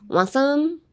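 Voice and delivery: "Want some" slurred into one word, rising; "Want some" joined up, no separate T. Shown as a demonstration. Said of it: "literally slur your words".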